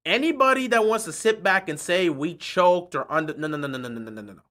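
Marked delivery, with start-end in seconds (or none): 3.3-4.4 trailing off quieter and lower; fading no's. Real, not acted. Rejecting the choke talk, fading to nothing. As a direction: let each no sink away.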